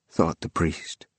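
ordinary delivery, nothing marked

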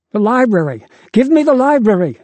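high, breathless yell; panting shout. Acted. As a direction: shout it while gasping for air. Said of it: "pants the boy".

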